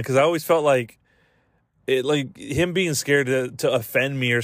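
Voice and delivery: ordinary delivery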